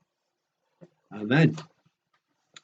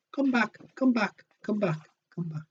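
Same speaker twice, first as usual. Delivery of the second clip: high quacking come back; a light duck-like call; quacking bird call; 9 semitones higher, 4 dB quieter than usual. Acted. Reader voicing the duck-like comeback bird, high and quacky. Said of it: "began to quack".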